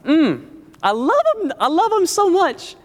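slow, loving, drawn-out; tender God. Retold or imitated. Preacher voices God loving his people, slow and tender. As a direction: say it like a doting father. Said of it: "He's like".

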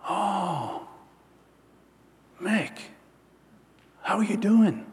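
lightly voiced, soft old man; a hint of slow, faint recognition; frail old boss.

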